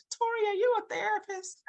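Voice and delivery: high, loud, laughing praise; laughing delight. Real, not acted. Host reacting to listener Tori's comment, laughing and delighted.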